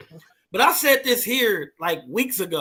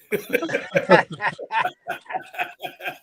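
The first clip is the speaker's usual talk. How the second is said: laugh. Real, not acted. Laughs at robot joke.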